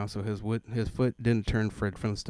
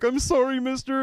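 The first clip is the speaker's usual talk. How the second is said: put-on high, louder kid's apology; little boy; 14 semitones higher, 6 dB louder than usual. Little boy apologizing to the dad, high. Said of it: "the kid was like".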